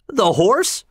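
very high, rising on The horse; can't believe it's the horse; The horse cried in high disbelief; astonished cry. Acted. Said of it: "cried both the colonel and myself".

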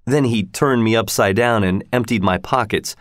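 ordinary delivery

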